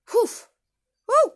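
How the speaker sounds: sudden high, loud whoop at herself; worked up. Real, not acted. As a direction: shake off the rage with a loud cry. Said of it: "Calm down".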